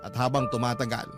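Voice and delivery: normal talking voice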